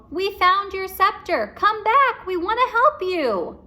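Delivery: high, loud call. A narrator's reading. Annie calling after the mummy, loud high call. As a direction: call into the dark pyramid. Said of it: "Annie called".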